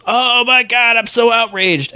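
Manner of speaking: high-pitched outraged crowd; mock outrage. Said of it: "Everyone goes".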